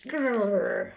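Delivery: playful growl; dinosaur growl. A narrator's reading.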